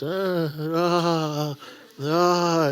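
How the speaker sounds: high, loud startled yelps of a nervous learner; panicky student. Retold or imitated. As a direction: gasp out the learner driver's panic.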